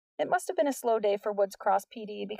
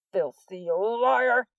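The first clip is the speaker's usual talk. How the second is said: slow, louder scornful sneer; contemptuous accusation; 4 dB louder, much slower than usual. A narrator's reading. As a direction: spit it out with contempt. McGuckin accuses Nix.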